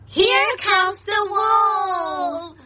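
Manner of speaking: high, very drawn-out wail; hammed-up panicked storybook wail; cried in alarm. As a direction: stretch the warning into a frightened cry. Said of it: "They'll cry".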